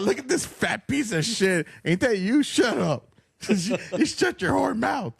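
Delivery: high mocking voice, slow and laughing; high-pitched taunting of a fat loser; mocking inner critic. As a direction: sneer at yourself in a high cartoon voice. Mocking self-talk voice.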